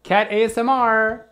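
excited announcement. Real, not acted. Announcing cat ASMR segment.

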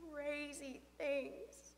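usual speaking voice

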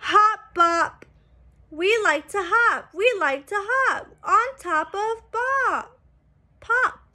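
bright, sing-song picture-book reading; reading to children. A narrator's reading. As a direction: read it out like a storybook for little kids. Book text read aloud.

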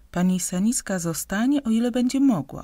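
plain reading voice

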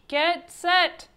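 high, loud starting call; coach's bellow. A narrator's reading. Coach readies the swimmers for the start, bellowing. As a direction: call it out to the whole team. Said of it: "bellowed the coach".

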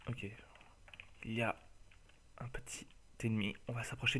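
soft unvoiced whisper. Real, not acted. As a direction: whisper so the enemy won't hear. Sneaking up on an enemy.

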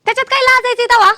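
defiant retort. Acted. Wife answering husband's shaming, defiant. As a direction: throw it back at him loudly.

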